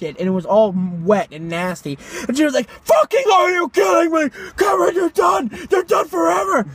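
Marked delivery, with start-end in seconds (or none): 2.7-6.7 high-pitched, strained impression of an angry parent; mock shriek of outrage; furious mock scolding. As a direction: imitate a parent blowing up, voice pitched way up. Retelling furious parent punishing Cameron.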